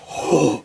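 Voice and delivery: sharp mock gasp of awe; a quick impressed intake of breath; gasp of excitement. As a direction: gasp as if the trailer just hooked you. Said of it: "And you're like".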